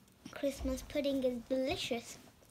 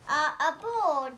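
plain reading voice

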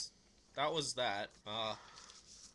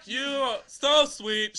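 loud, high, slow mock gushing; hammed-up sing-song love letter; funny letter reading; 9 semitones higher, 14 dB louder, slower than usual. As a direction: belt it out, dragging every word. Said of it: "I read them a funny way".

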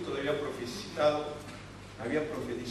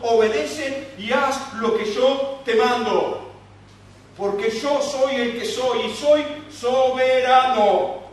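louder, higher, slow sovereign voice; commanding Jesus; 6 semitones higher, 10 dB louder, slower than usual. Pretend. Jesus telling Peter to stop comparing, firm sovereign command. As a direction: proclaim it with firm authority.

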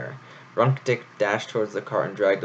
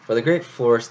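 usual speaking voice

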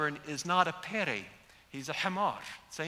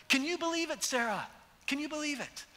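much higher, thrilled disbelief; Abraham can hardly contain it; amazed delight; 10 semitones higher than usual. Pretend. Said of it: "I'm wondering if Abraham came back".

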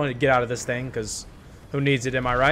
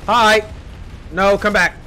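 taunting shout; 6 semitones higher, 7 dB louder, much slower than usual. Real, not acted. Taunting fleeing enemy.

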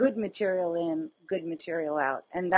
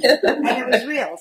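laughing; 4 semitones higher, 7 dB louder than usual. Real, not acted. Laughing insistence.

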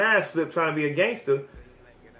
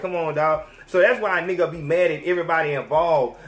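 ordinary delivery

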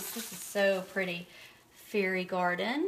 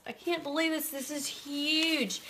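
amazed disbelief; 6 semitones higher than usual. Real, not acted. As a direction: let the disbelief burst out. Stunned by the big balm.

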